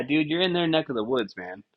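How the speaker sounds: ordinary delivery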